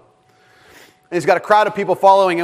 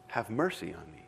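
hushed plea; 10 semitones lower, 18 dB quieter than usual. Retold or imitated. Beggar's plea voiced softly.

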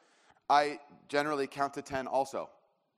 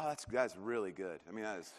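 mock serious; 8 semitones lower, 9 dB quieter than usual. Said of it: "I'm trying to, like, be serious".

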